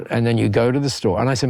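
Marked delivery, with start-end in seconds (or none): none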